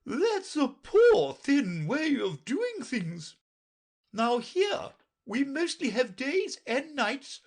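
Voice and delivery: put-on a bossy queen lecturing the girl; Red Queen voice. A narrator's reading. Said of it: "The Red Queen said".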